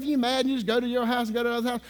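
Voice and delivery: ordinary talk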